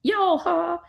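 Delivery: YALHA in a very high voice; playful high-pitched name. Shown as a demonstration. Narrator retrying the planet name Yalha, playfully high-pitched.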